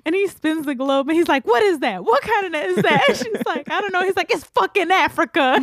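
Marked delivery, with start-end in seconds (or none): 1.5-5.6 high voice, laughing through retold porn lines; giggling while acting out both roles; laughing mock porn dialogue.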